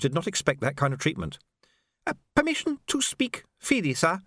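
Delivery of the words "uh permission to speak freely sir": put-on high, hesitant young soldier; timid request. A narrator's reading. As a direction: ask nervously, barely daring. Nervous messenger asks.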